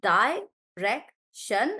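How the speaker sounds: direction drawn out slowly, syllable by syllable; slow syllable split. Shown as a demonstration. Teacher reading direction to the class, slowly syllable by syllable. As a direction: stretch direction into its three syllables. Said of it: "I will read the word like this".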